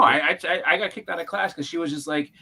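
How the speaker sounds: ordinary talk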